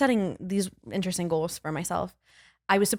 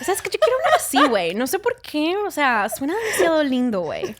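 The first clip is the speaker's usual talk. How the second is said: put-on high, animated Mexican slang accent; 7 semitones higher, faster than usual. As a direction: play up Mexican Spanish with a raised pitch. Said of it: "when they talk like that".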